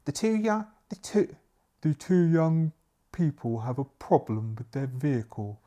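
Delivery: putting on a butler character; butler's voice. Acted. Butler explains the guests' trouble to the maid, character voice. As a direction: start high and halting as the butler. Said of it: "explains the butler".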